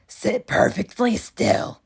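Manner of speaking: loud harsh witch voice; Miss Swamp stern. Acted. Miss Swamp cancelling story hour, loud harsh witch voice. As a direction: deny them coldly. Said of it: "said Miss Swamp".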